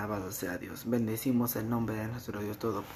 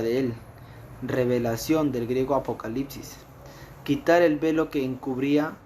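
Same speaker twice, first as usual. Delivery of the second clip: fervent preaching; 4 semitones higher, 8 dB louder than usual. Acted. Preaching at full force.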